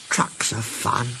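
soft and slow, full of longing; wistful. A narrator's reading. Stepney remembering his trucks, wistful and slow. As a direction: let it drift off, missing something. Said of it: "he went on wistfully".

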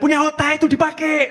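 high-pitched mock yell at a child; imitated scolding yell. Oknum ibu-ibu neriakin anak, mock yelling. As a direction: mimic a parent yelling, pitched well up. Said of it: "sama teriak-teriak".

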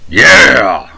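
sarcastic cheering yeah; mock excitement. Preacher answering the played verse, mock excitement. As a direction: cheer as if thrilled, clearly put on. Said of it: "Getting excited about killing Jesus".

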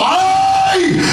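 shrill high ay of pain; mock yelp of pain; pained cry. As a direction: yelp it like the hammer hit. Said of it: "Así, hermanos".

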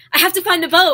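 loud, hammed-up urgency; acted panic. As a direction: cry out like a frantic cartoon parent. Acting out animated film scene.

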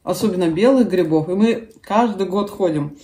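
speaking between spoonfuls; slightly full mouth; talking while eating soup. Real, not acted. Seen 3: Holds soup spoon while talking; lips pursed at times; spoon to mouth at 13.0.

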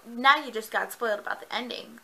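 ordinary talk

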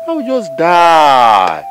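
high, long-drawn cry; loud despairing wail. Acted. Elder threatening to die over land, loud wail. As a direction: stretch it out loud and slow.

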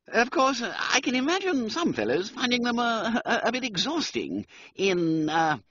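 high, faltering gentleman; stammering upper-class young man; groping toff. Acted. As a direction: search for the word with a nervous laugh. Wooster groping for a word.

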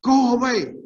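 a firm, loud rebuke. Retold or imitated. Jesus rebuking Satan, a firm loud command. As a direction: order the tempter away at once. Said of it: "but Jesus said".

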